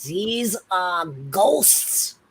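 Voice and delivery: put-on slow, drawn-out accented insistence; slow, accented repeat to make the point. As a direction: spell it out slowly, in character. Repeats point in acted argument.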